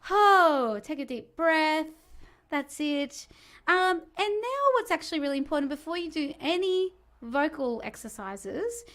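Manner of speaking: hammed-up aerobics instructor pumping up the class; Jane Fonda instructor. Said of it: "A bit Jane Fonderish".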